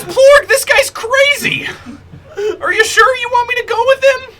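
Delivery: very high, loud creature wail; panicked squeal. Acted. Frightened snake begs Plorg about this crazy guy, panicked squeal. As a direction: squeal in alarm to the boss.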